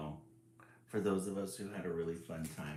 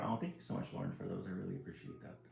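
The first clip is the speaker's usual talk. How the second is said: usual speaking voice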